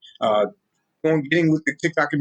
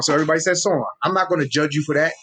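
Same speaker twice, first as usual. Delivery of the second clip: ordinary delivery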